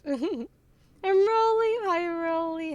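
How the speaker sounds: high, loud, drawn-out hello; excited greeting. Real, not acted. Streamer welcomes a viewer, excited high loud greeting. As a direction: stretch the name out in a high excited call.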